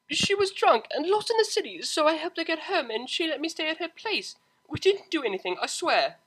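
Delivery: high, loud rushed explanation; blurting in a panic to mother; blurted defence. Acted. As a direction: blurt it all out. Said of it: "blurted Octavia".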